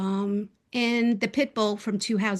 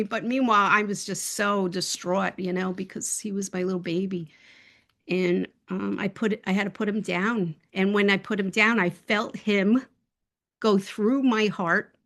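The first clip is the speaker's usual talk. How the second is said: usual speaking voice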